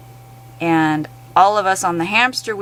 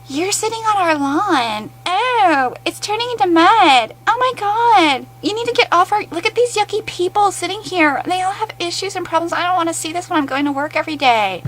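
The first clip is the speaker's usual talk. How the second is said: much higher, whiny complaining voice; mock whiny NIMBY; 11 semitones higher than usual. Vlogger mocks residents complaining about homeless on lawns, high whiny voice. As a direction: whine like a disgusted homeowner. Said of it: "what we get is".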